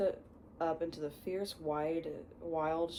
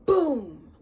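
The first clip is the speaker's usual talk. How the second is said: shouted sound-effect boom; loud boom; 8 semitones higher, 14 dB louder than usual. A narrator's reading. Reader voicing the door knock, booming. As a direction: bark out the knock like a blast.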